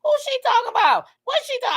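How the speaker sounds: very high-pitched puzzled questions; retold bafflement. Host reacting to an unknown name, retold bafflement. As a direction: squeak out acted-out confusion. Acts out own confusion.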